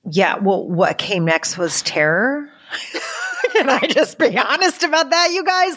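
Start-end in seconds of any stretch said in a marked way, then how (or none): 2.7-5.8 laughing confession, pitched much higher; laughing embarrassed. Real, not acted. Guest laughing to the podcast host about her terror. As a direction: giggle through the admission.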